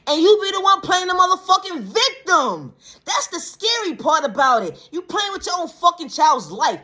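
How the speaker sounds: high-pitched, heated protest; indignant outburst. Real, not acted. Confronting a parent playing the victim, indignantly. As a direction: cry out in real outrage.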